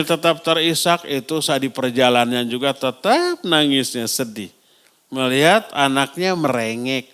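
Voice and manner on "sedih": much softer, lower and falling; hushed sad. Acted. Preacher telling of tearful parents on the road, soft and falling. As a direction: let the word sink away. Sadness on the journey.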